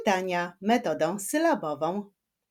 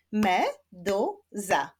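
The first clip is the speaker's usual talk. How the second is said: "Meduza" in three slow, separate beats; split into syllables; much slower than usual. Shown as a demonstration. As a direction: clap out "Meduza" slowly, syllable by syllable. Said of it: "Zapraszam do wyklaskiwania sylab".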